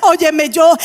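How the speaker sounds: loud fervent sermon cry, pitch up; full-force preaching. Acted. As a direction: preach it out to the congregation. (predicando a todo pulmón)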